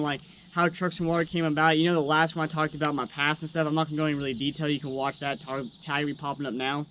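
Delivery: ordinary talk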